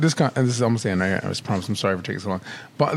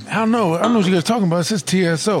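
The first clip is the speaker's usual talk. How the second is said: fast, higher-pitched insistence; acted stubborn protest; 6 semitones higher, faster than usual. Ray sat down still not getting it, acted stubborn protest. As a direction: imitate someone rattling off a baffled protest.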